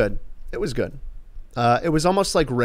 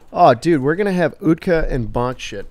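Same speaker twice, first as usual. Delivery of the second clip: usual speaking voice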